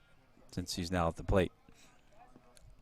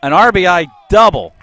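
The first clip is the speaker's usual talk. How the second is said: rising, much louder, higher home run call; excited play-by-play; 6 semitones higher, 14 dB louder than usual. Real, not acted. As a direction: build the call as the runner scores. Big hit play-by-play call.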